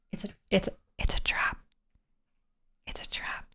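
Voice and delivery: hushed, voiceless warning; whisper. Pretend. Streamer jokingly warns chat, whispering. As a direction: breathe it like a secret.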